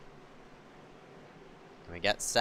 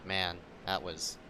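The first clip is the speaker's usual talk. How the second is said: quiet dismay; 5 semitones lower, 9 dB quieter than usual. Real, not acted. Reflecting on wreck.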